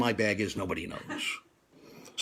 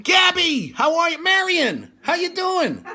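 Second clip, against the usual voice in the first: excited greeting; 4 dB louder, slower than usual. Real, not acted. Calling out greetings to cousins.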